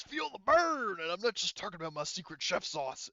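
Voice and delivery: high, loud in-character battle cry; battle shout. Acted. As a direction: shout it high like an action-hero one-liner. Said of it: "basically he shouts".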